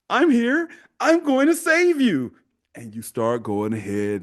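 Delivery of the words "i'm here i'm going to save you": high, stretched-out heroic proclamation; mock superhero. Boyfriend rushes to save wronged woman, mock heroic. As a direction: announce the rescue like a cartoon hero. Boyfriend plays the rescuing hero.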